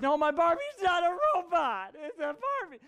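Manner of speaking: put-on high little-girl voice, firmly correcting; daughter's protest. Father imitating his daughter's protest, high childish voice. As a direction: mimic a small girl insisting on it. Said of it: "she's like".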